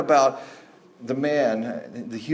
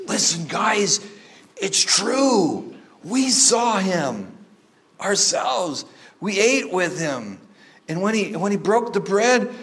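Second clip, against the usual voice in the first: higher, slower, earnest storytelling voice; like you can hardly believe it yourself; excited report; 6 semitones higher, slower than usual. Retold or imitated. Disciples telling the eleven, high and eager. Emmaus pair telling the others.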